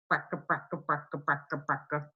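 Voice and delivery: low, soft rattling bracka; old car rattle. Retold or imitated. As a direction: sputter like an old engine. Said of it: "Old cars sound like this".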